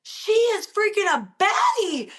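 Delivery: high excited shouting at the screen; hyped-up cheering. Retold or imitated. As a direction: cheer on your past self like a fan. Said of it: "Kelsey was making fun of me because I'm literally like".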